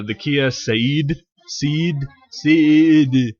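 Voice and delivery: fumbling over how to say "Ceed"; trying pronunciations. Shown as a demonstration. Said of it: "I don't know how to say it".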